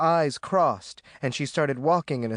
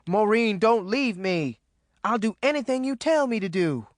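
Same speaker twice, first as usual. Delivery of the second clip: high, desperate begging; shouted plea; 7 semitones higher than usual. A narrator's reading. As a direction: call after her, begging. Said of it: "Ruby shouted".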